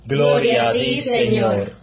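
high sung reply ringing in a big church; cathedral echo. Real, not acted. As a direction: chant it out into a reverberant nave. Sung response before the Gospel.

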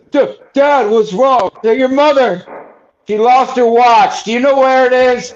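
high, loud frantic mock panic; panicked out of breath. Buddy imitates panicked son on phone, loud high breathless mock panic. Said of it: "He was all out of breath".

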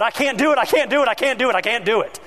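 high, desperate cry. Retold or imitated. Someone who keeps falling crying out, high desperate voice. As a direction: give up and cry out. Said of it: "you're crying out".

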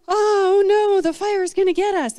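high, loud mock wail of panic; mock crying. Israelites panic as the camp burns, mock crying. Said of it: "start crying".

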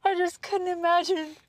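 trying to explain while cracking up; real giggles breaking into the sentence; laughing. Real, not acted. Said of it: "Why are you laughing?".

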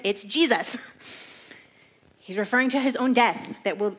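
ordinary delivery

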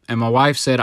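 ordinary talk, nothing marked

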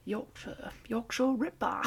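put-on hesitant stab at a Yorkshire accent; softly attempted Yorkshire twang; bad Yorkshire accent. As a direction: try a Yorkshire accent and miss. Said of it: "I don't even know what that accent is".

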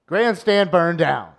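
loud, slow newsreel announcer; dramatic headline read. Acted. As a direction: proclaim it like an old newsreel. Reads old headline dramatically.

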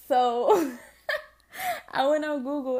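laughing through the words. Real, not acted. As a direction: crack up while telling it. Laughing about fake followers.

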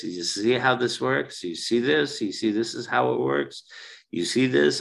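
ordinary talk

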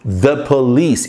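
I called the police, spoken slowly and clearly; careful slow teacher model; slow model sentence. Shown as a demonstration. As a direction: dictate it slowly to learners. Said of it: "Eu chamei eles, a polícia".

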